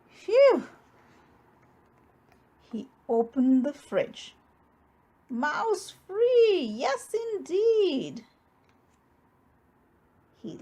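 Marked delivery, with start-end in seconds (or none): 0.1-0.7 loud, high relieved phew. A narrator's reading. Bear relieved the mouse is not there, loud phew. 5.3-8.2 triumphant bear. A narrator's reading. Bear cheering that the fridge is mouse-free.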